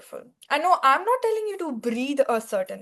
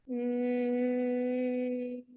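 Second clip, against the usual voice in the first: bee voice; 8 dB quieter than usual. Shown as a demonstration. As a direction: hum softly on the exhale like a bee. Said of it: "you make a buzzing sound like a bee".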